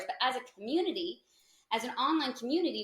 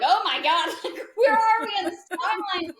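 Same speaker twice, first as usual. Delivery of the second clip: high, loud laughing mock panic; half-laughing, half-scared, retold; 10 semitones higher, 10 dB louder than usual. Retelling late-night doom talk, laughing.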